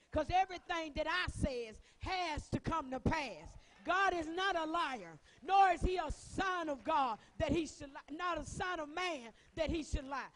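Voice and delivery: loud, high preaching that builds to shouts; fiery preaching. Acted. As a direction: preach it hard, proclaiming the promise.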